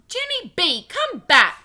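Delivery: high, loud summons; calling out. A narrator's reading. Mother calling back child who dived behind a bush, calling out. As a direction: call after a runaway child. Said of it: "called Mother".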